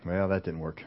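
low, very soft aside; muttered private thought. Acted. Moses thinking after his excuse fails, muttered low. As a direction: think aloud, deflated and under the voice. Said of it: "Moses is only thinking one thing".

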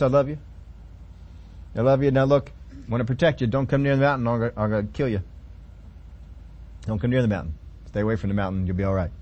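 soft and low, a loving warning; tender God voice. Acted. Preacher voicing God to his people, soft and low. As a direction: soothe them even while threatening.

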